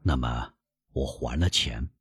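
put-on low, soft old man; sheepish old father. Father sheepishly confesses payment.

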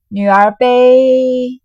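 dragged out slowly, loud and strained; strained blurt. Acted. Xue Pan, stuck for words, blurts loud and slow. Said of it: "急得眼睛铃铛一般，瞪了半日".

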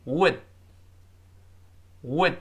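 "would" short, with the final d held; short isolated "would" for learners to repeat; quick would. Shown as a demonstration. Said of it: "快快地读".

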